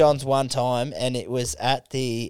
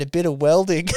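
laughing; 11 semitones higher, 4 dB louder than usual. Retold or imitated. Punchline, laughing.

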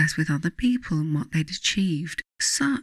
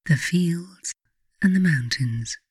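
guided meditation. Acted. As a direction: ease the listener gently toward sleep. Leads a bedtime guided meditation.

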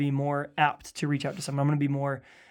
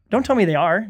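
usual speaking voice

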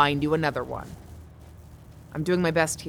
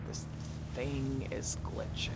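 whispering; 4 semitones lower, 8 dB quieter, slower than usual. Acted. GPS fails, muttered to herself.

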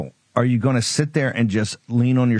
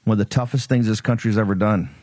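normal talking voice

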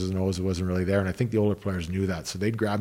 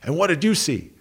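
imitating a fired-up coach, higher and louder; 10 semitones higher, 5 dB louder than usual. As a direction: bark the question like an angry coach between periods. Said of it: "he's all fired up".

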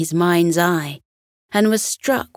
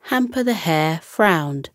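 usual speaking voice

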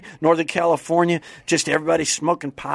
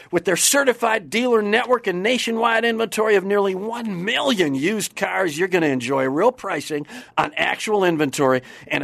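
upbeat, higher announcer ad read; 5 semitones higher than usual. Acted. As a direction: sell it like a radio spot. Sponsored ad read.

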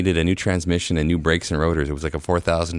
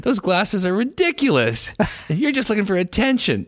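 snooty stranger. Retold or imitated. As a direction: mimic her sneer at the glasses. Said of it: "And she said".

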